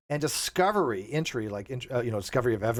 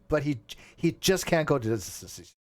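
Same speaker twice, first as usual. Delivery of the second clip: usual speaking voice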